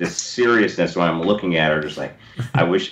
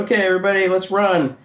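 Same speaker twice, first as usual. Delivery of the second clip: producer calling out; 12 semitones higher than usual. Retold or imitated. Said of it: "they said".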